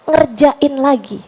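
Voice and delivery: normal talking voice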